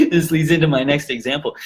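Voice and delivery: normal talking voice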